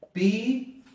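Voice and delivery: "pides" with a high, rising PI, pause, then DES; stress on the root. Shown as a demonstration. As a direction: split "pides", lifting the PI high. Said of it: "la fuerza de voz está cayendo aquí". ("pides" separado, con la fuerza de voz en PI)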